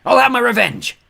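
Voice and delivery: loud, high snarling threat; snarled threat. A narrator's reading. Ghost threatens them while fading, snarling loud. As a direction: spit the threat as he fades. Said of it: "Claude snarled".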